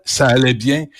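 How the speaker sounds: ordinary delivery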